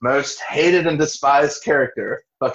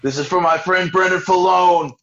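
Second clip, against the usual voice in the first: imitating Chris; 7 semitones higher, 3 dB louder than usual. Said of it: "and Chris says".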